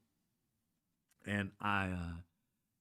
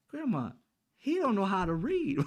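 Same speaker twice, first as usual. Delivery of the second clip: put-on cheeky kid; 11 semitones higher, 6 dB louder than usual. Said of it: "I would say".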